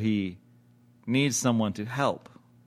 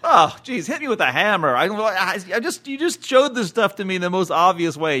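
comic exasperation; 6 semitones higher than usual. Acted. Mocks obvious plot retelling.

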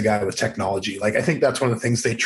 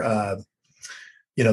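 ordinary delivery

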